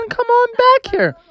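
a high, put-on call; lightly voiced character calling out. A narrator's reading. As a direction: call him back in a squeaky voice. Character calling after someone.

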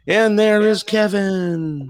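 high, drawn-out hail to the caller; show announcer intro. Real, not acted. Host welcomes Kevin calling in, drawn-out announcer hail.

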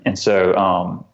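ordinary talk

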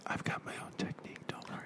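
unvoiced, very soft whisper; hushed seductive whisper. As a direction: breathe it like a secret promise.

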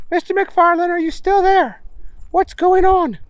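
high, bossy scientist voice; high professor voice. Acted. Stinkwell voiced in story.

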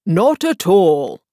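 drawn-out royal reply; lightly voiced king, slow. King answers Alice.

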